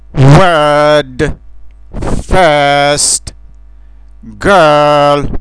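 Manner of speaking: put-on "Word", "First", "Girl" from the throat, mouth half open, said loud; loud throat vowel in "Word", "First", "Girl"; English er vowel. Shown as a demonstration. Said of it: "din gât cu gura ușor întredeschisă". (din gât, gura întredeschisă: "Word", "First", "Girl")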